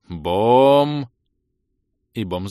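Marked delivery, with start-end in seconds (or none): 0.1-1.1 long, loud bong rising in pitch; drawn-out boom. A narrator's reading. Stone strikes the fighter, drawn-out boom. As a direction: imitate a resounding impact, held long. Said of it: "раздалось протяжное".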